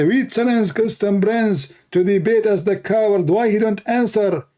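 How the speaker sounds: high, sing-song mocking taunt; taunting the dead man's coffin, high mock whine. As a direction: parody their call in a squeaky high voice.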